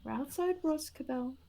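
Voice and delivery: calm, spaced-out reassurance; grounding reassurance. Acted. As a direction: soothe a panicked patient. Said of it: "just attempting to ground him".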